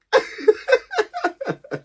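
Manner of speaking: high-pitched burst of laughter. Real, not acted. Vlogger amused at own ratchet TV habit, high laugh. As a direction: crack up in a high giggle.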